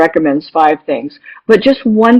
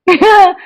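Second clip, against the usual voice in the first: short high laugh; 13 semitones higher, 4 dB louder than usual. Real, not acted. Laughs at her own memory.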